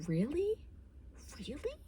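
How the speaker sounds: high, rising disbelief; can't believe it, pitch shoots up; incredulous. Real, not acted. Asking the audience about the wives, high and disbelieving.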